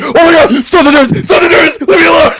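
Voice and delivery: high frantic yelling at the nerds; panicked shout. Acted. Monster Stein screams at Eric to back off, high panicked shout. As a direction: shriek to be left alone.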